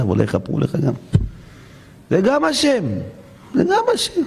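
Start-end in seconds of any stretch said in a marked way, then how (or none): 2.1-2.8 high mocking voice of acceptance; voiced much higher, hammed-up piety; mock pious acceptance. Voicing a resigned believer. 3.5-4.2 very high, strained mock voice; hammed-up, much higher pious whine; exaggerated mock piety. Voicing a resigned believer.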